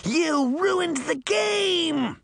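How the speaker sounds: very high, very slow shouted tantrum; raging shriek. Acted. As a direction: scream it like a furious child.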